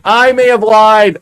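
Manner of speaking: loud, high, drawn-out confession; slow wail of owning up; dismayed admission. Real, not acted. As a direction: stretch it out in rising dismay. Repeats realization with more dismay.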